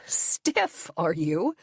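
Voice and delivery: stifled laughter at his excuse; mocking echo. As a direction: toss his word back, suppressing a laugh. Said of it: "I want to laugh".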